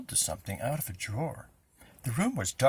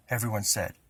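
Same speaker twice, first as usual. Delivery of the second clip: plain reading voice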